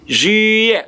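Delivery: "juillet" high, as zhwee-yeh; French pronunciation demo. Shown as a demonstration. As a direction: model "juillet" for repetition, silent t. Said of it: "Let me hear you say juillet".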